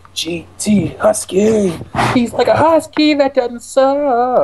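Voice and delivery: high, slow eighties ad announcer; toy commercial. Acted. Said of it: "toy commercial voice".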